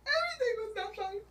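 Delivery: high, wailing laugh-cry; half laughing, half crying at the memory. Real, not acted. Telling a friend about being trapped, laugh-crying.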